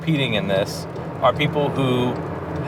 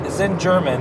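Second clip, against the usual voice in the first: usual speaking voice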